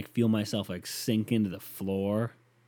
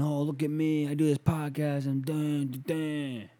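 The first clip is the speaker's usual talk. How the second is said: higher-pitched mocking singsong; mock a self-important podcaster, voice pitched up; mocking self-parody; 6 semitones higher, slower than usual. Podcaster parodying his own show for critics, mocking. Mocks himself as heard by critics.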